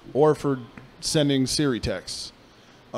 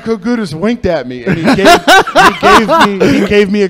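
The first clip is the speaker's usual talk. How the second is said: words breaking into real laughter; can't get through it for laughing; laughing while telling; 8 semitones higher, 11 dB louder, slower than usual. Real, not acted. Telling his co-host about the wink, laughing through the words.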